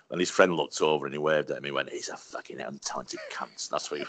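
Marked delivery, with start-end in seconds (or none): 1.9-3.7 much lower, softer imitated put-down; low aside. Actor's friend privately warning comedian, low dismissive aside. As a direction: drop it right down, like a confidential dig. Friend waves, insults him behind back.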